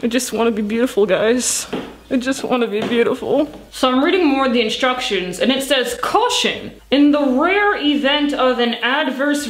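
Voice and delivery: speaking through foamy face mask; wearing a sheet mask. Real, not acted. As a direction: lips stiff under mask. Seen 2: Bubbling sheet mask covers whole face while speaking; lips exposed.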